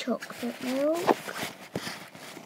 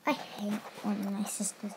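ordinary delivery

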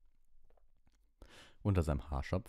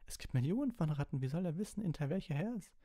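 put-on very high, insistent boy's voice; high-pitched, flustered reasoning; sceptical protest; 11 semitones higher than usual. A narrator's reading. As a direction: argue squeakily against an absurd claim. Ron argues in disbelief.

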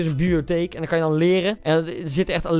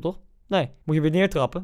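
ordinary talk, nothing marked

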